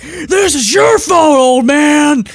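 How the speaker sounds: slow, high, loud shout of blame; mock yell. Friend retelling the kid yelling at the grandfather. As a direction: bellow it at the old man. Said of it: "And then he's like".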